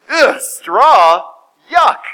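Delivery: loud, comic disgust. Acted. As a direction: recoil from it like a picky eater. Lion rejects straw in disgust.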